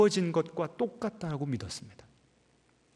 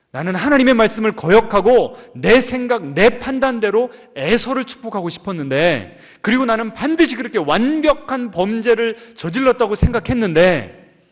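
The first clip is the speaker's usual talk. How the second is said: loud, higher confession; impassioned; 5 semitones higher, 7 dB louder than usual. Acted. Preacher voicing Isaac's confession, loud and impassioned. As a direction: pour out the guilt.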